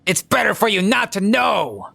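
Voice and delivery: high-pitched ominous warning; hammed-up high voice; crazy conspiracy nut. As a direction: warn like a paranoid crackpot. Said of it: "they're a fucking crazy person".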